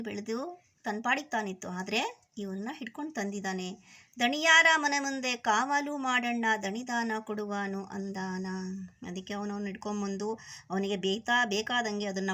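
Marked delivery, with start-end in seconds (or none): 4.2-8.5 poem recitation. Acted. Teacher reads the bear-dance poem to children, sing-song recitation. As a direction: chant the verse, stretching each closing word. Recites verse before explaining it.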